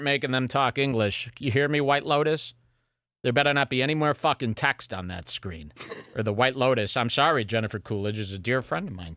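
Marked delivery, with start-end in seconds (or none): none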